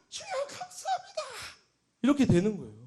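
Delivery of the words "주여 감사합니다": very high, thin squeal of thanks; overjoyed prayer cry. Retold or imitated. So happy they thank the Lord, high squealed cry. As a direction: squeal it high, held back in volume. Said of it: "더 기쁘니까".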